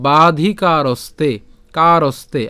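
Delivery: slow বাধিকারস্তে with long vowels and joined "ste"; careful Sanskrit pronunciation. Shown as a demonstration. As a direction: draw out বাধিকারস্তে, keeping স and ত together. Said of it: "দন্তাস্য যুক্ত ত আমরা একসাথে উচ্চারণ করছি". (ধীরে বাধিকারস্তে, স ও ত একসাথে)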